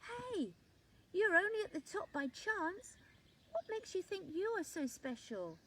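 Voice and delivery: high, small voice calling up; small leaf calling up. A narrator's reading. Small green leaf calling up to Top Leaf, high and cheeky. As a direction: call up to him cheekily. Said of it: "called up to him".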